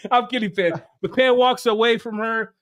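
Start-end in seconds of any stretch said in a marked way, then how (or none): none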